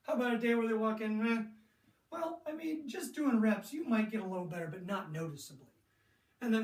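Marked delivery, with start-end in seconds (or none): none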